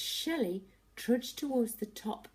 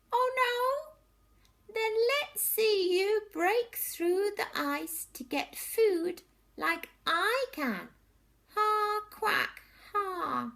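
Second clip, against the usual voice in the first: taunting duck; 7 dB louder, slower than usual. Acted.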